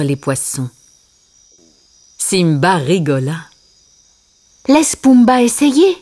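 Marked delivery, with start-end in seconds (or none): 4.7-5.9 lightly voiced higher character; character suggestion. A narrator's reading. Simba wants Pumba to try, higher character voice. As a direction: suggest it with raised pitch.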